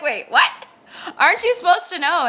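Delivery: loud, high, laughing surprise; laughing disbelief. Retold or imitated. Asking her tongue-speaking friend, loud laughing shock.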